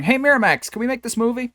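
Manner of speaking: lightly voiced pleading child, pitched high; a hint of a sweet, eager child voice; hopeful little kid asking. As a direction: ask like a small kid begging for candy. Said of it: "and going".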